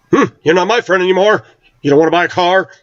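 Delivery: hurt salesman voice, high and fast; mock salesman sulking over the lost sale. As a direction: pout like a snubbed salesman.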